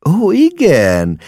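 high, drawn-out delighted exclamation; happy cry. A narrator's reading. Mama finally understands the officer, happy cry. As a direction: cry out slowly in happy relief. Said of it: "kiáltott mama boldogan".

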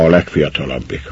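ordinary delivery, nothing marked

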